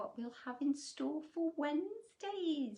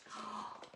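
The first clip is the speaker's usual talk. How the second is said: soft surprised gasp; 8 dB quieter than usual. Real, not acted. Reader reacts to picture.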